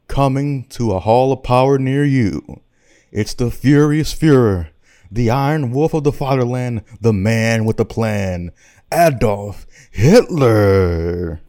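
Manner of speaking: hype announcer. Acted. Comic introducing the Furious Fuhrer to adoring fans, hype announcer. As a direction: build the hype and stretch out the final name. Hyping Hitler's entrance like an announcer.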